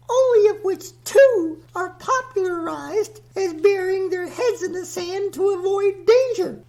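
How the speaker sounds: high, airy goddess voice pitched well up; a lofty earth goddess lecturing the host; Mother Nature voice. Acted.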